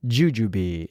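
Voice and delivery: normal talking voice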